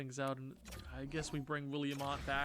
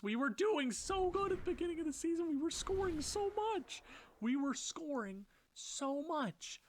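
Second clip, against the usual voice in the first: whiny lament; 13 semitones higher than usual. Pretend. Whining about team's decline.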